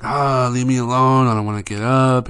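loud, grumpy morning whine; cranky whine. Retold or imitated. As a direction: groan it out like a kid who won't get up. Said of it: "cranky, just like Dad".

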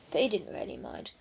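usual speaking voice

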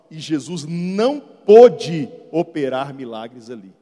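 slow, loud reading stressing pôde; emphatic scripture. Acted. Bishop quoting the verse to the church, emphatic. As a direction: lean hard on pôde.

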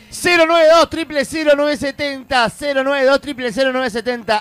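announcer giving the call-in number; radio announcer read. Acted. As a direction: sell the phone number like a radio jingle. Reads the show's phone number.

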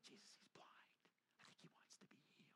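hushed aside to Jesus; whisper. Retold or imitated. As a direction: whisper it so the blind man won't hear.

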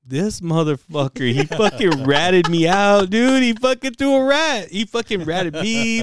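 high, slow, indignant retelling; mad outburst. Retold or imitated. Stoned sibling learns he was ratted out, mad retold outburst. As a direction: act out outrage at being ratted out, loud and drawn out. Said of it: "I was fucking mad at him".

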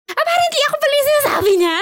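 sudden high-pitched loud exclamation of surprise; shocked realization. Real, not acted. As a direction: blurt it out in disbelief. Realizing she was the one. (gulat na gulat, biglang tumataas ang boses)

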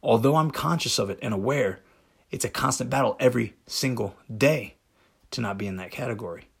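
ordinary delivery

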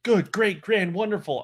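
loud, high comic outburst; hammed-up exasperated bus driver yell; Chris Farley. Host quoting a movie to his co-host, mock yelling. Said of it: "channeling my inner Chris Farley right now".

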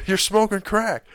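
high, loud mock disbelief; imitated dad. Speaker quoting dad to mock the idea, imitated dad. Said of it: "that's what my dad says".

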